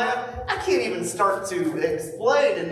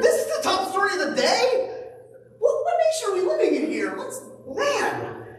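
high, laughing disbelief; incredulous scoff. Retold or imitated. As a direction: scoff at the news in mock outrage. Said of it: "I'm like".

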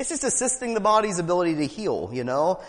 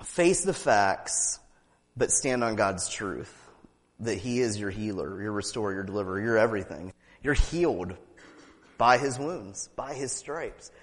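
intimate declaration; 9 semitones lower, 3 dB quieter than usual. Real, not acted. Preacher assuring the listeners of healing, soft. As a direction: speak it softly into each heart.

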